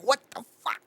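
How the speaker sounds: high, hushed mock disbelief; baffled disbelief. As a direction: act out a baffled reaction under your breath. Retelling baffled reaction to film.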